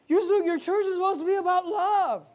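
high-pitched mock complaint; mocking high critic's voice; confused media critics. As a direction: mimic a whining objector, pitched well up. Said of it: "these media people, they're all confused".

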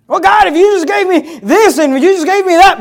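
high, pestering complaint in imitation; mock whining plea. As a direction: nag God like a dissatisfied believer. Mimics believer complaining to God.

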